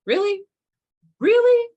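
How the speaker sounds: high, loud, incredulous cry; shocked exasperation, shooting up high; incredulous really. Retold or imitated. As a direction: squeal it in disbelief. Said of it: "And the universe is like".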